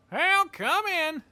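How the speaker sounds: squeaky, loud, drawn-out dog voice; Ben. Acted. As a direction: call out slowly in a very high squeak. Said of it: "Just fucking squeaky fuck".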